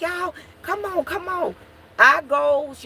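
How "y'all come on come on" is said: urgent calling. Retold or imitated. Hurrying the children inside.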